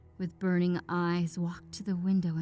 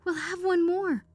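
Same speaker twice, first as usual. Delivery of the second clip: high and louder, frantic command; frantic urging; 10 semitones higher, 6 dB louder than usual. Acted. Wife ordering husband to fetch the paw, frantic. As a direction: drive him out of bed with desperate hope. Wife demanding second wish.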